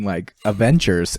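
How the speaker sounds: ordinary talk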